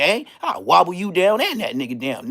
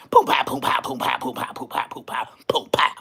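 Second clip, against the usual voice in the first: punch noises; 3 semitones lower than usual. Retold or imitated. Imitating punches.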